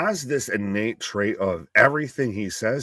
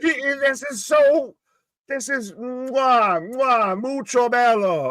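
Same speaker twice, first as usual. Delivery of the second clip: chef's kiss voice; 9 semitones higher, slower than usual. Pretend. Chef's-kiss praise, slow and high.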